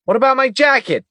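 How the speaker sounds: very high, loud protest. Acted. Agent to partner about his ruined jacket, protesting. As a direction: complain hard about the jacket. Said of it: "Tanner protested".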